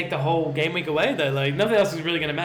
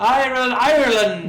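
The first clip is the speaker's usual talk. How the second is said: loud, higher mock Irish accent; 6 semitones higher, 6 dB louder than usual. Co-host joking about player's nationality, in mock Irish accent. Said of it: "That's my Irish accent".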